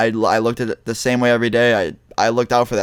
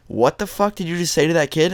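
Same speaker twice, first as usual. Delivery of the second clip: higher-pitched, mildly angry confrontation; retold rage; 6 semitones higher than usual. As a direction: replay an outraged challenge without shouting. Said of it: "I was so enraged at this kid".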